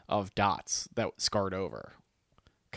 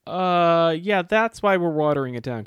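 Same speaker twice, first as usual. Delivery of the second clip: sassy retort; 7 semitones higher, 8 dB louder than usual. Retold or imitated. Barista sassing customers.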